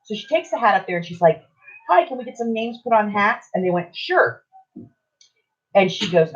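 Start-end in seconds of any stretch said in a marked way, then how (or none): 1.9-3.5 lightly voiced cheery customer, higher pitch; polite request. Daughter asking hat counter staff for names, lightly imitated politeness. 3.9-4.4 short, high mock-obliging reply; agreeable clerk. Hat counter staff answering daughter, high agreeable imitation.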